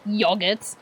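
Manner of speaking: yogurt with a short o, drawn out low; dad's British pronunciation. Retold or imitated. Streamer imitating UK dad saying yogurt, British pronunciation. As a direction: say yogurt slowly with a British short first vowel, as in "yog". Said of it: "instead of saying yogurt like a normal person".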